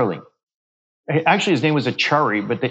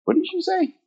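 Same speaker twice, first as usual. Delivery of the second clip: much higher, sudden alarmed question; retold shocked reply, pitched way up; startled coworker. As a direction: mimic a coworker jolted by what he heard. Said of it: "He goes".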